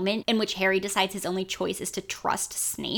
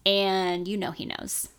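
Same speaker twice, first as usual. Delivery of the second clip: ordinary talk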